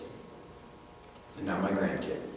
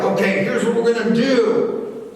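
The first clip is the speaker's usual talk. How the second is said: stern parent; 9 semitones higher, 5 dB louder than usual. Retold or imitated. Parent laying down the law.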